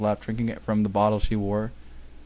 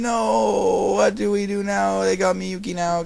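high, drawn-out mock wail; hammed-up high-pitched panic; panicked wail; 9 semitones higher, slower than usual. A narrator's reading. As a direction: wail it in exaggerated despair. Acting the characters' panic.

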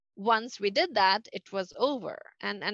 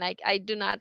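usual speaking voice

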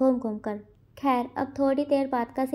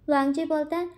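ordinary delivery, nothing marked

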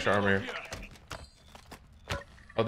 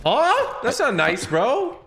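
mock offended; 13 semitones higher, 5 dB louder, much slower than usual. Mock offence at enemy reply.

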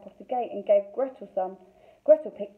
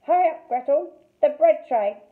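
impatient call; 6 semitones higher, 8 dB louder than usual. Acted. Witch hurrying Gretel.

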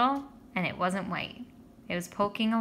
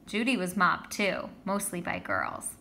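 ordinary delivery, nothing marked

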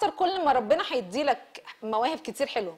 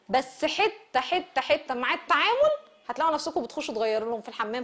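ordinary talk, nothing marked

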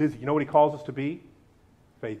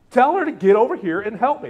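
louder, higher bossy demand; bossy order; 5 semitones higher, 6 dB louder than usual. Acted. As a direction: snap out the order like an annoyed sister. Martha's order retold.

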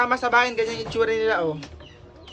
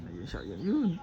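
ordinary delivery

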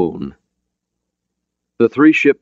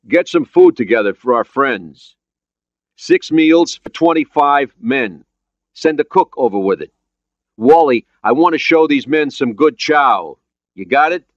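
louder, higher commanding voice; radio command; 9 semitones higher, 5 dB louder than usual. Acted. Skipper ordering meals for the new friends, raised command. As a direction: give hearty orders into a radio.

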